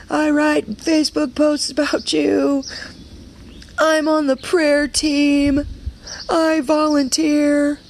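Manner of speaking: angry prayer. Retold or imitated. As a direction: rage at God, listing everything done for him. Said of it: "I was angry and I was bitter at God".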